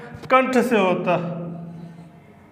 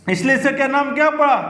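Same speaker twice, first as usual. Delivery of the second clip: normal talking voice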